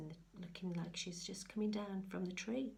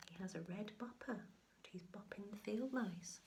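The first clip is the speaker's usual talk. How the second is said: whisper; 5 dB quieter than usual. Real, not acted. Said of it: "whispered aside to child".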